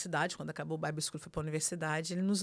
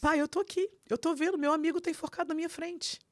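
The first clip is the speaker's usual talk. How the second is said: high, loud, urgent re-enactment; retold distraught son; 10 semitones higher, 3 dB louder than usual. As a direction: mimic a son panicking on the phone. Distraught son reporting friend's death. (imitando o filho em pânico)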